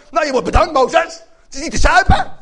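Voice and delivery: loud, high acted complaint; mock-furious grumbler at the leader; sarcastic outrage. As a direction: shout it in bitter indignation.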